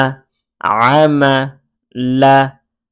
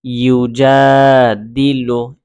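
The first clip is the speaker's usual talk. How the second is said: measured, connected recitation; reading it joined; much slower than usual. Shown as a demonstration. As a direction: link the syllables, holding the long vowel. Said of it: "এইভাবেও পড়তে পারেন".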